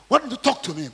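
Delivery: high, loud demand. Acted. Pilate demanding an answer from Jesus, loud and high. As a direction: press him for an answer. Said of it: "Pilate demanded".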